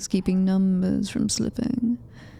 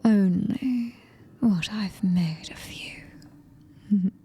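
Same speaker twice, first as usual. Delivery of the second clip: ordinary talk, nothing marked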